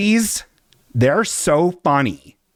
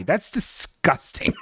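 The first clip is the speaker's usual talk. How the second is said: mock disgust; 5 semitones higher, 6 dB quieter, slower than usual. Shown as a demonstration. Reacting to a follower's joke name, soft slow mock disgust. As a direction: murmur it in mock revulsion. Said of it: "Very funny".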